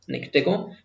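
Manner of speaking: ordinary talk, nothing marked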